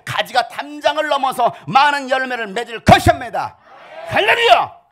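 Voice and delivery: preaching at full force. Acted. Pastor lifting the congregation to praise, shouted hallelujah. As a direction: build to an ecstatic hallelujah.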